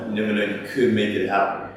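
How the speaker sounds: normal talking voice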